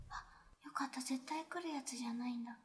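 relieved whisper. Real, not acted.

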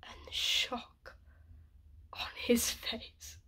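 very slow, breathy near-whisper; stunned, whispered recollection; hushed shock. Acted. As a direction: let the voice drop away, drawing out each word. Reliving the moment the stone hits.

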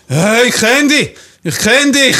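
put-on loud high slurred drunk voice; drunk stranger. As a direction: play the tipsy guy who recognises him. (lauter, hoher betrunkener Fremder)

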